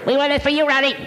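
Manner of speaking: dubbed-in helium cartoon squeak; helium Mickey Mouse. Shown as a demonstration. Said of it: "Let's get a helium voice".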